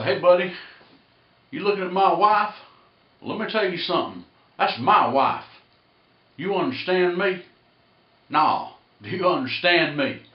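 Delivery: put-on loud, high, confrontational West Tennessee accent. Husband confronting a man about his wife, West Tennessee accent. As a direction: warn him off in thick West Tennessee talk. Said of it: "This is the accent I'm gonna use".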